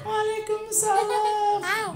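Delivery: very high, slow, sung wail of a female ghost; bride's eerie high sing-song reply; ghostly kuntilanak voice. Acted. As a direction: croon it like a kuntilanak in the dark. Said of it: "siun kuntilanak".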